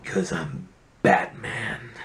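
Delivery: slow, gravelly Batman line. Pretend. Answers in Batman voice.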